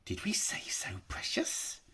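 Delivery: whispered creature voice to himself; Gollum whispering. A narrator's reading. Gollum muttering to his precious, whispered. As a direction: hiss it secretly to the precious.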